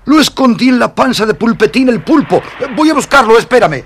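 thrilled, high and loud, already on the move; eager excitement. Acted. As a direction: call it out eagerly as you hurry away. Eager to fetch the ointment.

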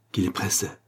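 usual speaking voice

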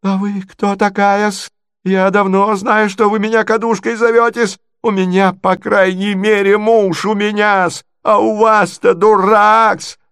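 high, slow, emphatic squawking retort; outraged provincial lady. Acted. Natalya hurls insults back at Marya, high and outraged.

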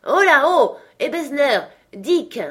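loud, high, sonorous hail; powerful, jovial call. A narrator's reading. Fezziwig summoning his two apprentices, powerful jovial call. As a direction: call out heartily in a rich, full voice. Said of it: "appela d'une voix puissante, sonore, riche, pleine et joviale".

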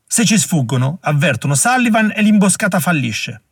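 a narrated yell at a comrade, pitch rising; shout. A narrator's reading. Butler shouting in Hogg's face to warn him, urgent yell. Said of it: "urlò in faccia a Hogg".

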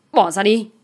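a restrained narrated shout. A narrator's reading. Man held back from punching his rival, mildly shouting. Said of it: "anh quát".

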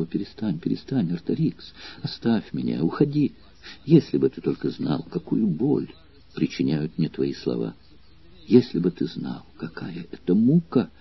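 soft, halting half-whisper; breathy, pleading, words broken by pauses. A narrator's reading. Trembling girl begs Artorix to stop and leave, in a broken whisper. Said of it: "Она прерывисто шептала".

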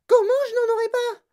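very high, loud indignant shriek; Veruca Salt's yell. A narrator's reading. Spoilt girl told no, yelling. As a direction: scream in outrage. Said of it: "hurla Veruca Salt".

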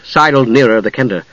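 plain reading voice

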